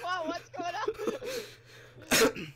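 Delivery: high squeaky laughing voice. Retold or imitated. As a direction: giggle through the quote. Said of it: "I was like".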